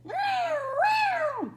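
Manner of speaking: high, loud wailing yowl; cat yowl. Acted. A cat stuck up a tree yowls, high and loud. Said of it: "the sound that echoed around".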